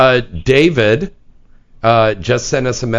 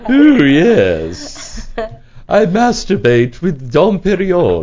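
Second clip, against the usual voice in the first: put-on laughing posh rich-girl voice; mock snooty socialite; Beverly Hills snob; 3 semitones higher, slower than usual. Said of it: "that little Beverly Hills thing".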